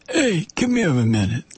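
lightly imitated Italian-American relative; a hint of Italian wiseguy; family's Italian accent. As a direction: summon someone in a mafioso Italian accent. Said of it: "they only did that in".

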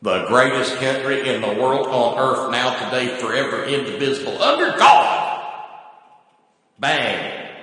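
a hint of a gun-loving patriot; hammed-up patriot. Mock patriot praising his country, hammed-up.

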